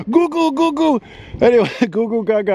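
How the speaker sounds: high, playful goo-goo babbling; giggly goo-goo nonsense voice; silly baby talk. Pretend. Playful baby talk after laughing.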